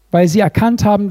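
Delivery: normal talking voice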